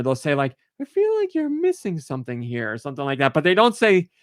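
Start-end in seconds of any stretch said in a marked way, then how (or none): 0.8-2.7 high-pitched hinting flower voice; talking flower. Retold or imitated. Flower hinting to a player who missed something, high imitated voice. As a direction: pitch it way up, imitating a little game character. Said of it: "you pass that talking flower".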